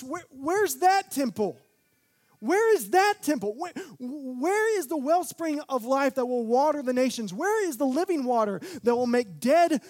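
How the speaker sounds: put-on high, loud, pleading cry of old men; anguished lament. Old men at the second temple, crying out loud in grief. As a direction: wail it like mourners at the ruins. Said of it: "The old men wept whenever they saw the second temple because they said".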